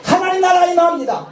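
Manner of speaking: high, shouted climax; proclaiming shout. Real, not acted. Preacher proclaims the kingdom to the congregation, shouting. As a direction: declare it at the top of the voice.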